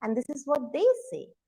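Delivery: ordinary delivery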